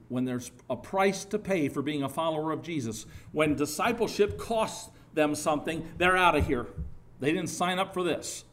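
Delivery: ordinary delivery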